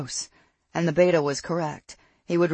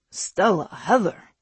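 raised, sharp reprimand; pulling them up short; snapped; 4 semitones higher, 5 dB louder, slower than usual. A narrator's reading. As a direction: snap the names as a warning. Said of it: "Soren snapped".

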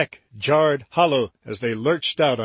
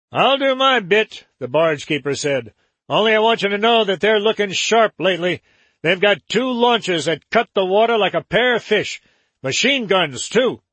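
much higher, fast river man; high barge keeper voice; 6 semitones higher, 4 dB louder than usual. Acted. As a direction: chatter it out, pitched up high.

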